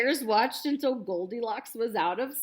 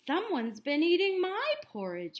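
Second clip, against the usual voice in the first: mild wail; 6 semitones higher than usual. A narrator's reading. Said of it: "wailed Mama Bear".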